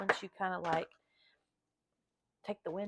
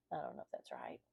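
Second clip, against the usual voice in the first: a much lower, whispered unsure aside; whispered doubt; 9 dB quieter than usual. Real, not acted. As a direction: mutter it under your breath, unsure. Unsure aside after pronunciation.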